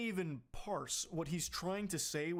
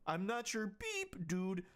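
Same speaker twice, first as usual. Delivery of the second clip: hammed-up whiny man reading his texts; high mocking whine; mock angry boyfriend; 3 semitones higher, 17 dB louder, slower than usual. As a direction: squeal the censored rant like a sulking kid.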